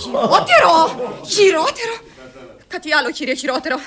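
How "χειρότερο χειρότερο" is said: high, loud, drawn-out cry; wild outcry. Acted. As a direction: cry it out to top what was said. Girl outbids the word "crazy. (άγρια, δυνατή κραυγή)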